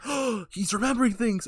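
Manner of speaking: mock astonished delight; excited realization. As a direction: imitate a hopeful, amazed onlooker. Family notices memory returning.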